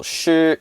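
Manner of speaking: "Shu" held high and level, first tone; high level Mandarin tone; high flat tone. Shown as a demonstration. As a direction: sing "Shu" on one high steady pitch. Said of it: "is a flat tone".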